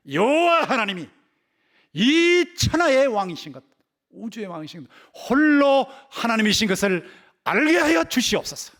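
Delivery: loud, high, drawn-out acted plea to God; crying out in prayer. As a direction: cry out like a besieged king praying, stretching the words. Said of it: "이러고 막 울부짖고 기도하는 거예요".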